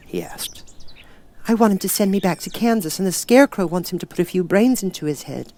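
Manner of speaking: little Dorothy explaining simply. Acted. Dorothy speaks.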